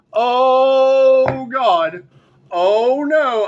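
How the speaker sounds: drawn-out, high exclamation; loud dismay. Real, not acted. Reader reacting to a suspected cover-up, loud dismay.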